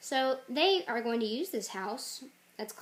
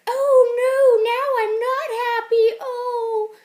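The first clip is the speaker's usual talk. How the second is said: loud, high, hammed-up wail; wailing young man; 13 semitones higher, 16 dB louder, slower than usual. Young man smacked by the toddler, high mock wail. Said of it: "and he's like".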